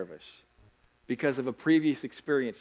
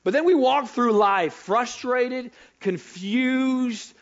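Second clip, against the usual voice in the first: high, loud pleading questions to God; frustrated believer; 9 semitones higher, 4 dB louder, slower than usual. Acted. Believer asking God where he is, high and loud. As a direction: cry out to God, lost and upset.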